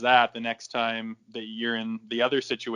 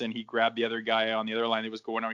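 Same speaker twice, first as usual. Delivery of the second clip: ordinary talk, nothing marked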